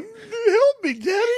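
put-on high, loud child's voice begging for help; little kid pleading. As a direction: play the toddler crying out to daddy. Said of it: "he's like".

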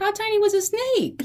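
high, alarmed question; fearful high-pitched question. Real, not acted. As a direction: squeak it out in fright. Fearful question about snake.

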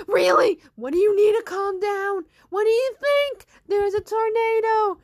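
loud, high, incredulous retort; exasperated shouting. Acted. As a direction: yell back in alarm. Acted heated retort.

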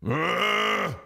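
just grunt at her; lazy one-syllable grunt; mock grunt. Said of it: "he turned to one of the daughters".

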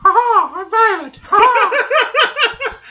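very high, loud falsetto with laughter; female voice. Acted. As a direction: laugh through a shrill falsetto lady. Mocking friend's failed female voice.

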